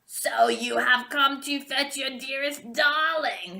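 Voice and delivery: a touch of a sneering witch; mocking sorceress. A narrator's reading. Sorceress taunting the prince who came for Rapunzel. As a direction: taunt him with the darling. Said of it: "she cried".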